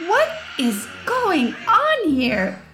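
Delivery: slow, high-pitched startled demand; a mother bursting in on chaos; mother shouting. A narrator's reading. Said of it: "Mother shouted".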